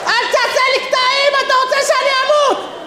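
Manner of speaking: very high shrieking accusation; hysterical shouting. Acted. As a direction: scream it in mock panic. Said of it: "מה אתה צועק?". (צרחה היסטרית בקול גבוה מאוד)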